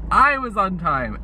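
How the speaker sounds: "I was on time" drawn out, high; slow rising "I was on time". Shown as a demonstration. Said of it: "I'm on time".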